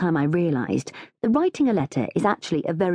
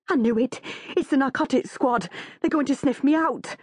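high, panicky character voice; anguished; 9 semitones higher than usual. A narrator's reading. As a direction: fret aloud in melodramatic dread. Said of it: "Janice emits an anguished cry".

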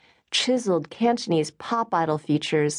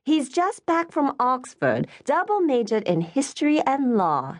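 Charlotte adding; 8 semitones higher than usual. Acted. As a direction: answer the next question before your mother asks it. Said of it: "Charlotte added".

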